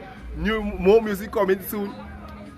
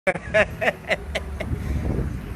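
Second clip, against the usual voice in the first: laughing; 4 dB quieter than usual. Real, not acted. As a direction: chuckle in a long run of bursts. Laughing at own money request.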